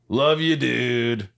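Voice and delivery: slow, casual bro tone; awkward faux-casual; mock cool. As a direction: play it too cool. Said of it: "trying to be cool about it".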